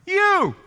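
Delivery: "You" pitched high and stretched; emphatic YOU. Shown as a demonstration. Preacher showing where the prayer's emphasis lies, high emphatic "You". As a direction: hit "You" hard, high and falling. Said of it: "Where's the emphasis here?".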